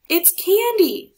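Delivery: high, loud, slow delighted cry; excited scream. A narrator's reading. Marco seeing candy thrown from the float, delighted scream. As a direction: shout it with glee. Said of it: "screamed Marco".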